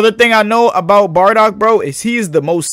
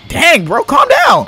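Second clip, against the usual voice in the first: surprised; 12 semitones higher, slower than usual. Real, not acted. Startled by game.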